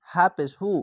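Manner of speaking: ordinary delivery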